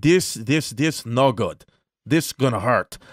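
lightly imitated Russian accent; halting warning, light Russian accent; Russian doctor. Doctor warning her patient of pain, light Russian accent. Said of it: "this is a Russian doctor".

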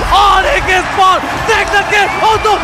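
excited play-by-play. Acted. Final push call.

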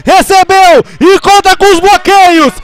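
high, loud rising run call; excited play-by-play. Real, not acted. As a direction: build it toward the score. Run toward end zone.

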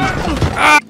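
sudden high startled yelp. Real, not acted. Gamer spooked by a ghost, high startled yelp. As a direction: jump at the scare with a yelp.

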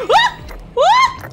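loud, very high shriek; startled scream. Real, not acted. Gamer ambushed by creatures, startled scream. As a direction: scream at the sudden attack. Attacked in game.

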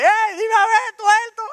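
put-on very high, loud heckling kid voice; taunting schoolmate. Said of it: "al tigre que me dice". (compañero burlón gritando en voz aguda)

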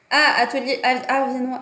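ordinary talk, nothing marked

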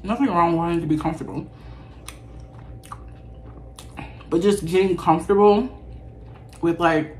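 talking while chewing; biting a pepper; mouth full, chewing. Real, not acted. Seen 1: Chews bitten seafood, then bites pepper at 6.2; burger untouched.

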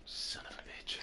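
breathy whispered swear; whispered curse. Real, not acted. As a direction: hiss it under your breath.

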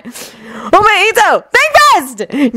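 high, drawn-out call; mischievous announcement. A narrator's reading. Jun tosses cards at his president, calling out playfully. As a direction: sing out a warning as you throw. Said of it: "Jun announced".

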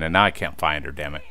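ordinary talk, nothing marked

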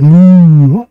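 loud, high drawn-out cow moo. Acted. Parent reading to a child, loud cow moo. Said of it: "cow said".

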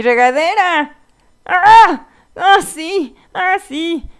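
loud, high whoops of joy; gleeful exclaiming; excited delight. Real, not acted. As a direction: celebrate a lucky find loudly. Delight at finding a shower.

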